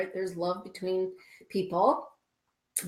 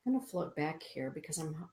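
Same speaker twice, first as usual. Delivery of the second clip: ordinary delivery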